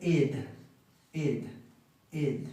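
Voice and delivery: "id" with a short i, not a Spanish e; each "id" set apart, falling softly; "id" sound. Shown as a demonstration. Teacher corrects the vowel for students, "id" sound. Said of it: "No es ed necesariamente".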